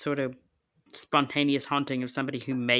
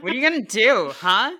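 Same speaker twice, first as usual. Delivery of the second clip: loud teasing provocation; playful taunt; 5 semitones higher, 8 dB louder than usual. Pretend. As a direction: goad him, grinning. Mock challenge to Steve Huff.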